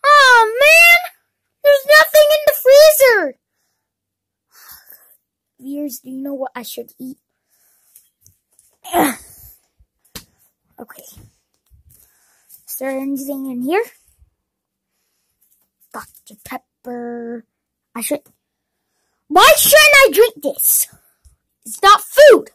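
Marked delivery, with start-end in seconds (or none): 0.1-3.4 high loud complaining cry; dismayed shout. Acted. Empty freezer dismay. 19.3-22.5 high loud self-argument; indignant shout. Acted. Arguing with self.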